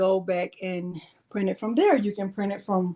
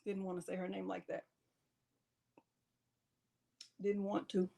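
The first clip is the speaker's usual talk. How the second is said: sheepish mutter; 3 semitones lower, 17 dB quieter, faster than usual. Real, not acted. Sheepish aside after shout.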